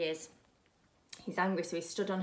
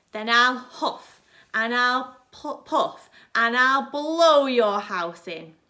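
loud, slow, booming wolf threat; slow shouted threat to blow the house down; big bad wolf roar; 4 semitones higher, 14 dB louder, slower than usual.

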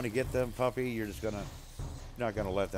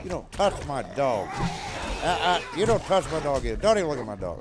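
loud, stern warning; scolding the attacker to protect his dog; angry scolding; 5 semitones higher, 6 dB louder than usual. Real, not acted. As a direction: protective yell over the dog.

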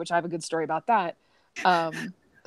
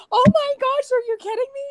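very high, loud squeal of disbelief; 5 dB louder than usual. Retold or imitated. Artist to the crying fan, high excited squeal. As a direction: shriek it like you can't believe it. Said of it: "I was like".

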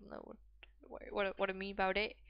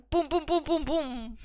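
loud, high booming noises; imitated banging; 7 semitones higher, 11 dB louder than usual. Gamer mimicking the banging outside, loud booms. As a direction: boom out each thud, raised in pitch.

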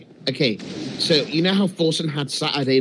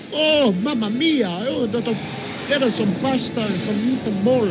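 higher, hammed-up Italian accent; mock Italian accent; 4 semitones higher than usual. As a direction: put on a cartoonish Italian accent. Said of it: "Italian's like this".

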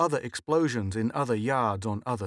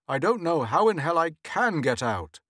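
frustrated; 5 semitones higher, 4 dB louder than usual. A narrator's reading. Trapped husband fumes.

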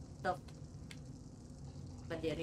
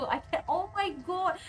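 anguished; 8 semitones higher than usual. Real, not acted. Upset over lost creature.

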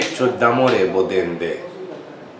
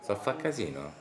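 usual speaking voice

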